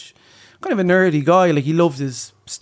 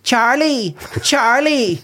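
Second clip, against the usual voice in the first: imitating mom calling; 9 semitones higher, much slower than usual. Boy as mom calls up to dad, calling out. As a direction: call up like mom from below. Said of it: "So I went".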